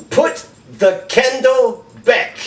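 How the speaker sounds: slow, high mock German accent. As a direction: put on a hammed-up German accent, stretched out. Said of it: "That's my German accent".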